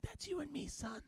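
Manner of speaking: tiny high hushed voice, choked-up dad; tearfully moved. Retold or imitated. Dad to his baby son at Lion King, tearful hush. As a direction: squeak it very softly, as if overcome. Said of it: "Disney movies make me cry".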